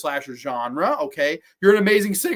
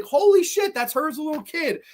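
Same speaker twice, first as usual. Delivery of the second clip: surprised exclaim; 9 semitones higher than usual. Retold or imitated. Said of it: "So I was like".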